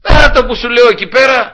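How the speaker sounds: loud, high re-enacted shout; angry yelling. Machine operator snapping at his helpers, angry loud shout. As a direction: yell an order as if out of patience. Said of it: "θύμωνα".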